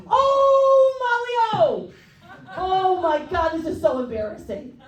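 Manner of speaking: high wailing yell that eases down; mock distressed holler, starting very high; Tommy Neptune. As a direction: imitate a flustered tour manager hollering. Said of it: "it's more of a yelling".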